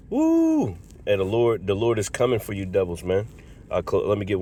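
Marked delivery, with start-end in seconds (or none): 0.1-0.8 high whoop; preacher's whoop. Real, not acted. Preacher whooping high at the judgment verse. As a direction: whoop it out high like a revival shout.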